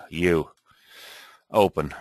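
ordinary delivery

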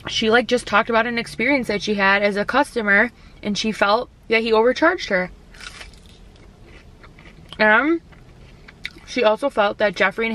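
holding food, mid-chew; chewing while talking. Real, not acted. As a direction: mouth partly full. Seen 4: Chews bitten food piece at -1, keeps chewing between words.

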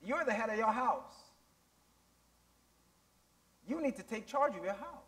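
softened, measured divine instruction; Lord's quiet word. Retold or imitated. Preacher voicing the Lord's answer to a father, quietly instructing. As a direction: retell God's counsel softly, with pauses. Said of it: "And the Lord sh-, showed him, he says".

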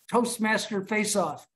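ordinary talk

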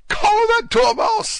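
slow, very high piercing shriek; furious falsetto screech from a Queen. A narrator's reading. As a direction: shriek the order at full force. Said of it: "the Queen shrieked out".

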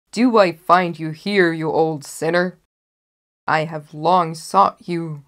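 loud booming hunter, slowing at the end; triumphant huntsman. Acted. Huntsman catching the sleeping wolf, loud and triumphant. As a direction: roar at the old sinner you've hunted.

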